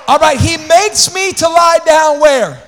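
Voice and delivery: normal talking voice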